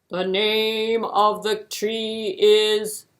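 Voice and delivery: slow, loud, regal king's voice; booming king. Acted. King grandly telling hare the tree's name, loud and slow.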